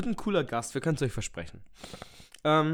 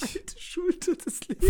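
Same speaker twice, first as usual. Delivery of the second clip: mocking repeat; slower than usual.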